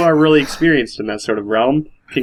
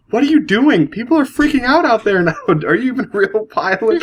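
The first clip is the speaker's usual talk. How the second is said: flight attendant storming in; 10 semitones higher than usual. Retold or imitated. Retelling a skit, higher flustered flight attendant. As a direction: burst in scolding the pilot. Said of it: "She's like".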